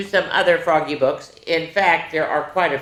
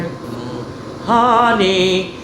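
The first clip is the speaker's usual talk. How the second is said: hummed "Mm" then a relished "honey"; savouring honey; 2 semitones higher, much slower than usual. Acted. Daddy Bear tells his cub about honey, savouring it. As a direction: savour the word like a treat.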